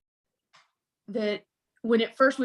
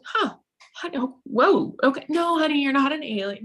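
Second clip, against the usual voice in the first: put-on higher, startled mom reassuring her child; startled surprise; 5 semitones higher than usual. Mom caught off guard by her daughter's alien claim, startled. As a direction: caught off guard, then soothing.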